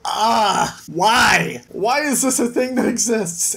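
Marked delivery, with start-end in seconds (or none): none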